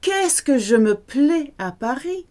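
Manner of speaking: mock French delight; delighted exclamation. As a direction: gush about loving Paris. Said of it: "the French will say something like".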